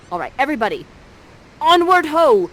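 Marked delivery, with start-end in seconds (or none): 1.6-2.5 loud, high stage command; rallying call. Acted. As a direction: call the troupe onward like a leader. Sends the players marching off.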